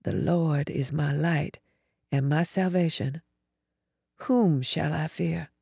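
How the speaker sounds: softly murmured scripture, voiced; soft, hushed recitation of a psalm; whispered prayer. A narrator's reading. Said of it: "I whispered the beginning of Psalm".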